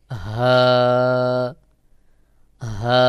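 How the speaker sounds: হা breathed freely from the throat, held; long Arabic হা without throat pressure; fully open হা. Shown as a demonstration. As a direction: let air out on an open, unblocked হা. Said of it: "একেবারে ফাঁকা আওয়াজ হয়ে".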